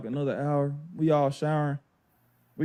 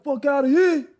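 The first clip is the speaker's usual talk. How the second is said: high, loud mock dismissal; retold trash talk, pitched way up; imitating trash talk; 12 semitones higher, 5 dB louder than usual. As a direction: act out a cocky player dismissing his opponent.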